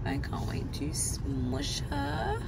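soft, slow whisper of delight; tender whisper. Real, not acted. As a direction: breathe it out fondly, barely voiced. Cooing over ultrasound baby.

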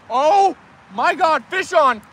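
real thrill at the hook; excited cry. Real, not acted. Telling viewers a fish is on, excited.

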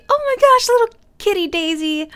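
high, loud squeal of joy; delighted squeal. Real, not acted. Gamer squealing after naming the kitten Daisy. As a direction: squeal over the newly named kitten.

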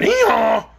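loud braying hee-haw; donkey bray. Acted. Mule Hank answering the dragon's flame, loud bray.

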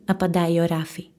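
plain reading voice

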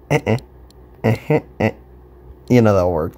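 ordinary delivery

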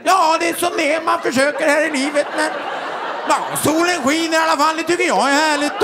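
high-pitched, singsong imitation of dad; dad talking to himself. Dad skiing uphill alone, high cheerful self-talk. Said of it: "Prata för sig själv istället".

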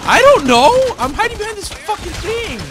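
high, loud panicked yelling. Real, not acted. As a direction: yell it in real panic under fire. Panicked under fire.

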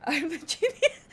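soft, giggled boast; laughing. Real, not acted. Streamer tickled by own boast, laughing. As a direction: laugh through the claim. Laughing claim, then restated boldly.

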